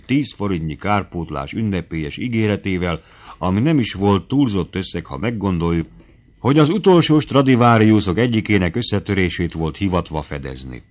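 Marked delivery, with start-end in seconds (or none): none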